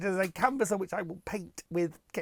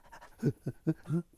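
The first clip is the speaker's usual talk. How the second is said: quick excited doggy pants; imitated dog panting. Vlogger doing the sketch's dog between fetches, eager panting.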